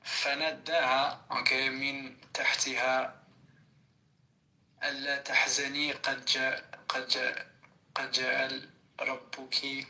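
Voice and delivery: reads the Arabic verse in a measured reciting voice; Quran recitation. Real, not acted. Friend recites the verse for the group, measured reciting voice. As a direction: recite it like reading scripture aloud.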